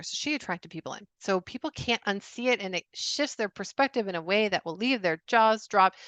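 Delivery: usual speaking voice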